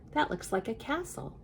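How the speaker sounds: plain reading voice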